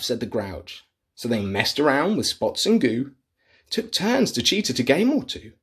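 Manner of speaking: plain reading voice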